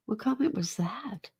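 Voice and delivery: a quick unvoiced whisper to herself; whispered aside. Real, not acted. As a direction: drop to a whisper, half to yourself. Aside while reading chat comments.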